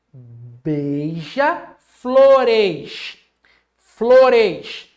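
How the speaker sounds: beija-flores very slow, punching flores loudly; emphatic plural. Shown as a demonstration. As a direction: stretch beija, then hit flores hard. Teacher stressing the plural form.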